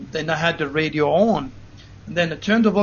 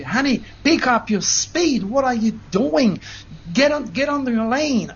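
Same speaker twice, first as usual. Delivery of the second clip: high, mock panicked yell at the driver; imitating a wife shrieking for him to speed up; retold scream; 5 semitones higher than usual. Wife yelling at her husband to get back in his lane, high mock scream. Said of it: "my wife is screaming".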